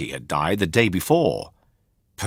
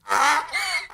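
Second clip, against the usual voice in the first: gasp; 3 dB quieter than usual. Acted. Pinocchio finds donkey ears.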